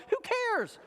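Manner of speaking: very high-pitched, incredulous dismissal; mock exasperation. Preacher scoffing at his own viral video, mock exasperation. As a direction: toss it out in squeaky disbelief. Mocks trivial video description.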